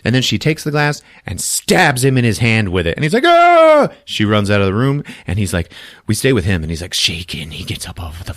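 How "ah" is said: high, loud yelp of pain; long high comic "ah"; mock pained scream. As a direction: scream like you've just been stabbed, hammed up. Acts man stabbed in hand.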